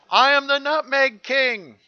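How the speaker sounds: high, loud proclamation of a mad king; grand boast. Acted. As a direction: crow it from the treetop.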